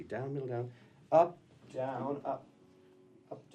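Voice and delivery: ordinary talk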